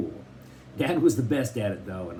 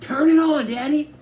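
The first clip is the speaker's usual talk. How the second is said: loud, very high begging call; eager chorus; 8 dB louder than usual. A narrator's reading. The kids begging Dad together, loud and high. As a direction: kids pleading all at once.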